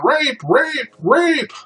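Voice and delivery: high mock cries for help; mock woman's scream. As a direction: call it out high like the victim. Woman screaming in retold scenario.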